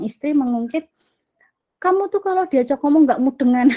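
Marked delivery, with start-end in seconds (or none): 1.8-3.7 nagging wife. Retold or imitated. Wife nagging her husband.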